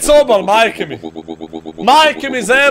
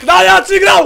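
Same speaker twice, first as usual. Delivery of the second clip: excited shout; 6 semitones higher, 6 dB louder than usual. Real, not acted. Celebrating win.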